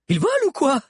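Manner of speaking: very high, disbelieving question; astonished cry. A narrator's reading. Sabi watching the pursuers race closer, astonished cry. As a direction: cry out in disbelief at their speed. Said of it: "cria Sabi".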